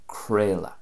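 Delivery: "creala" as KRAY-la; Munster pronunciation. Shown as a demonstration. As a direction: say "creala" with an A sound in middle and end. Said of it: "pronounced in Munster".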